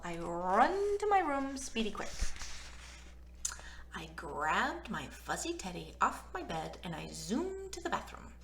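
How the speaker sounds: usual speaking voice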